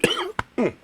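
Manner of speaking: sharp real coughing fit. Real, not acted. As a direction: choke and cough hard. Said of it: "choked on that".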